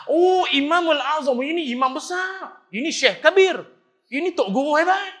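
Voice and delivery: high, loud child mocking with fake awe. Boy mockingly hailing Imam Abu Hanifah as a great scholar, high and loud. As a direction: gush sarcastically over a big title.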